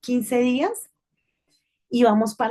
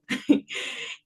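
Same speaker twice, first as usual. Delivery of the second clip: a quick breathy laugh; short laugh; 3 dB quieter than usual. Real, not acted. Caught out by her husband's fair point, a quick breathy laugh.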